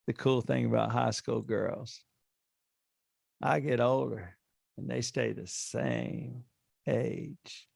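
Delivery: slow, soft laid-back drawl; McConaughey line. Acted. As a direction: ease through it like a slow Texan charmer. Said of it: "Matthew McConaughey".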